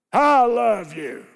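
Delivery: "I love you" shouted slow and hard; harsh loud. Shown as a demonstration. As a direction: bark "I love you" coldly. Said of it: "Any difference in that?".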